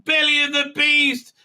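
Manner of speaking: high, loud hammed-up dread; mock dramatic alarm. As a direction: announce it like a melodrama.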